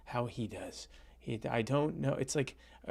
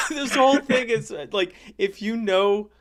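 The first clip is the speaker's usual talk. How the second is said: laughing; 8 semitones higher, 9 dB louder than usual. Real, not acted.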